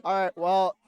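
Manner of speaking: ordinary talk, nothing marked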